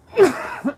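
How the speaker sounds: loud real chesty cough. Real, not acted. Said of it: "Still coughing that house out".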